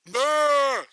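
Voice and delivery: loud blubbering brr; mock manly sob; guy's cry. Shown as a demonstration. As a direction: blubber it like a grown man. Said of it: "guys primarily cry like".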